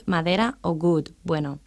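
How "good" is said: "good" opened with a hard g; G sound. Shown as a demonstration. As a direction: model "good" with a g stop at the start. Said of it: "no podrán producir diferencialmente las palabras".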